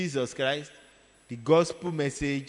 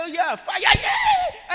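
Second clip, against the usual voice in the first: much higher, much louder possessed yell ending in a laugh; frenzied shout; 10 dB louder, much slower than usual. Retold or imitated. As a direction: shriek the fire call wildly. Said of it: "He said".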